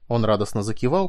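ordinary delivery, nothing marked

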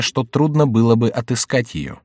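plain reading voice

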